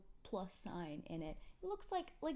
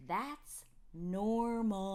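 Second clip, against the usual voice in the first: put-on slow, drawn-out, louder kid voice; condescending child; 2 semitones higher, 7 dB louder, much slower than usual. Mother imitating daughter, talking down to her. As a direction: explain to an idiot. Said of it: "She says it like that, like I'm an idiot".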